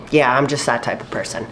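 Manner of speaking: ordinary delivery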